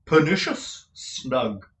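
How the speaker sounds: slow, theatrical actor's voice; pompous tragedian. A narrator's reading. Lenville admiring Nicholas's room, slow and grand. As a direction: roll it out like a stage tragedian.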